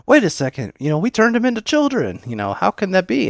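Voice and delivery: a voice cracking up high in alarm; freaking out. Retold or imitated. Goma panics when told the children are in the Demon World, high and loud. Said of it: "he starts freaking out".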